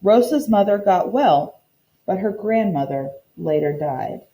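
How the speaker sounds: ordinary talk, nothing marked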